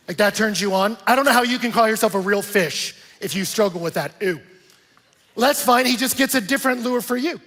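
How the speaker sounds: fired-up preaching. Acted. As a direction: preach it out to the whole room. Preaching at full force.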